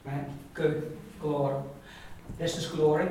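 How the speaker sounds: ordinary delivery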